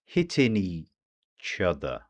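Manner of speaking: slow "Hitting each other" run together as one word; "Hitting each other" slowed down but fully linked. Shown as a demonstration. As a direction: glide "Hitting each other" into a single stream. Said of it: "One word, or so it seems".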